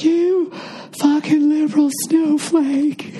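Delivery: high, slow jeering voice with a laugh; mock conservative. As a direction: sneer it like a caricature right-winger. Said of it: "they have to be like".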